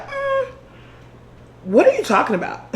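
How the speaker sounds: high coy hmm then laughing question; playing dumb. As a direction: fake innocence with a giggle. Feigning innocence.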